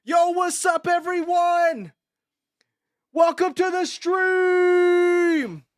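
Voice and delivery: high, loud, slow hyped streamer shout; mock stream intro. Streamer imitating a stream opener from a drawing, hyped. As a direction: belt out a parody stream welcome. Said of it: "Just me showing up to stream?".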